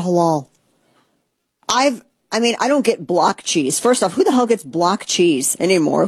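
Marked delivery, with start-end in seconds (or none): none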